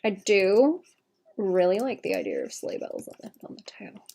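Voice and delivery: ordinary talk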